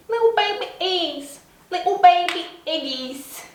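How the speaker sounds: put-on baby talk. Cooing at tiny eggs.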